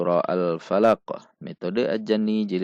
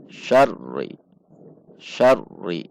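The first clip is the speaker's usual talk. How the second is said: "Syarri" dengan r tertahan singkat lalu disambung; seperti hendak berhenti; 2 semitones lower, 4 dB louder, much slower than usual. Shown as a demonstration. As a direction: hampir hentikan r, lalu naik ke "ri" pada "Syarri". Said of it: "Seperti itu". ("Syarri" nyaris terputus)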